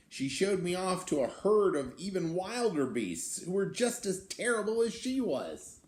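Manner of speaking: put-on lively read-aloud for children; storybook reading. A narrator's reading. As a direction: tell it like a picture book to kids. Reads the beast's tale aloud.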